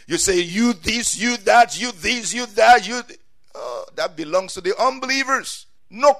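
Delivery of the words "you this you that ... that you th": loud, high mock tirade; angry cursing. Someone cursing another out of anger, mock angry tirade. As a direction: rattle off mock insults angrily. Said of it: "curse somebody because you are angry".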